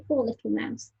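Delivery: plain reading voice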